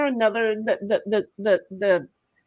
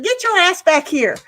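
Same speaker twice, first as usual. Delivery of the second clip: high, loud playful yell; hollering at a friend to come back; mock yell; 8 semitones higher, 6 dB louder than usual. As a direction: holler it like calling a kid home. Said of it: "It was like".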